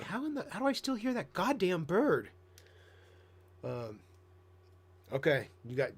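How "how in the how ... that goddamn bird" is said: 1 baffled outburst. Retold or imitated. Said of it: "and I'm like".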